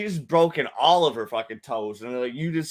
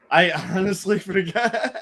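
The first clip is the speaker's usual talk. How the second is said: laughing; 4 semitones higher, 4 dB louder than usual. Real, not acted. Laughing at own forgetting.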